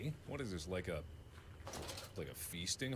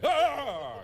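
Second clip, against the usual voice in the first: startled yelp; 12 semitones higher, 7 dB louder than usual. Real, not acted. Startled by a jump scare.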